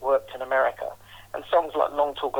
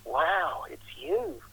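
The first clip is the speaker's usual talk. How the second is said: impressed; 2 semitones higher, 6 dB quieter, much slower than usual. Retold or imitated. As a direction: imitate a quietly awed admirer. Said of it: "kind of impressed".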